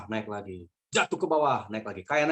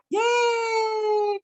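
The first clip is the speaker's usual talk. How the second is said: very high, long yay; child's gleeful scream; 4 dB louder than usual. Retold or imitated. Two small children as the plane plunges, joyful shriek. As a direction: imitate kids shrieking with delight. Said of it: "dia teriak".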